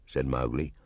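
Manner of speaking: plain reading voice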